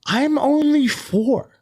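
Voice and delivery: put-on high, drawn-out little-kid voice; four-year-old daughter. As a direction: imitate a small child pleading, slow and high. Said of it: "she goes".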